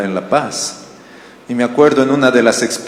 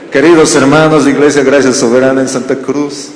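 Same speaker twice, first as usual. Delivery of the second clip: louder, laughing through a pompous letter opening; laughing mock letter; 2 semitones higher, 6 dB louder than usual. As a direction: read it like Paul from jail, cracking up. Joke letter from prison. (leyendo una carta solemne de broma entre risas)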